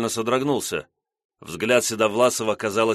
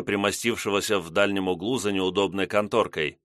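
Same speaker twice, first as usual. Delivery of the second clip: ordinary delivery, nothing marked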